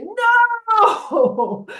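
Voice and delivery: high, sudden crowd shout of no; high crowd yelling no; shouted refusal. Acted. People inside refuse Godzilla.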